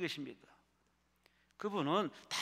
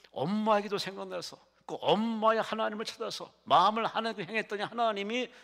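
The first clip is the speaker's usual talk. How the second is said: high-pitched, swelling sermon delivery; impassioned preaching; 7 semitones higher, 6 dB louder than usual. Acted. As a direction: drive the story home from the pulpit. Preaches with rising force. (높은 톤으로 힘주어 설교하듯)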